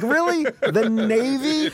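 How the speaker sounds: high incredulous squeak, slow on Navy; disbelieving, drag out The Navy. Retold or imitated. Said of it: "I'm like".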